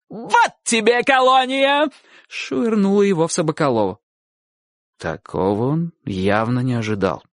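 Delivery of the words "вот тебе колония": high, drawn-out defiant yell; battle cry. A narrator's reading. Aunt flinging flowerpot at dog-catcher, yelling. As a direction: yell it while hurling something. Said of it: "и с криком".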